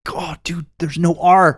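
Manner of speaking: mock fed-up correction; exasperated. Grandson protesting grandpa's pronunciation, mildly exasperated. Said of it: "It drove me nuts".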